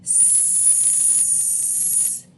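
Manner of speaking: very long voiceless S hiss; drawn-out s like a snake. Shown as a demonstration. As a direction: keep the S air going without stopping. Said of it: "Don't stop the air until you reach the top".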